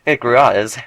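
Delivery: ordinary talk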